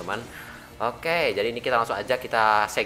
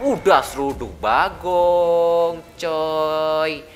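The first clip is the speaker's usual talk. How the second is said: hype call; 6 semitones higher, 6 dB louder, much slower than usual. Acted. Hyped slow call.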